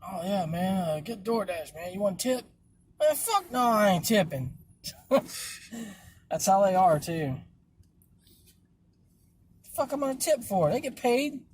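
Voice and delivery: lightly voiced twitchy addict, drawn out; tweaker voice. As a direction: play a strung-out tweaker, slow and slurred. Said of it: "Do your tweaker voice".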